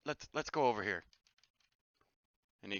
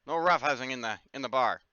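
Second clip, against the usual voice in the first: louder, scolding innkeeper; barkeeper warning; 4 semitones higher, 8 dB louder than usual. Acted. Barkeeper scolding the players for roughhousing, louder. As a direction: tell the brawlers off. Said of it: "Akio's gonna come over here and be like".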